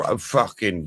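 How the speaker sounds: ordinary talk, nothing marked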